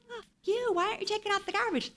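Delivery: mock angry teen outburst; pissed-off teenager. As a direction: whine it like an irritated teenager. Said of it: "That's your teenager".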